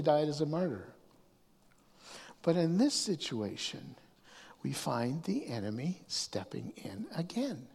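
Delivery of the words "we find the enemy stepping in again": whisper. Real, not acted. Dramatic hush on the enemy.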